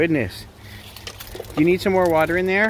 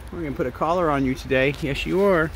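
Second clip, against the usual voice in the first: ordinary talk, nothing marked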